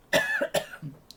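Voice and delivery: sudden harsh cough; coughing fit. Real, not acted. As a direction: cough hard mid-sentence. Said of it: "je tousse, je tousse".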